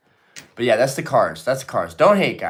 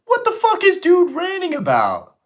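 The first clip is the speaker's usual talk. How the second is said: much higher, squeaky mock question; mock chat voice; 4 dB louder than usual. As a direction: parrot the viewer's comment in a silly high voice.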